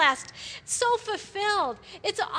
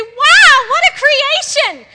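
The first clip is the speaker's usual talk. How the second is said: much higher and far louder, shouted in delight; amazed wow; 10 semitones higher, 16 dB louder, slower than usual. Shown as a demonstration. A woman at the mirror, shouting amazed praise. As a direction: cry out in wonder at yourself. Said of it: "Look in the mirror and say".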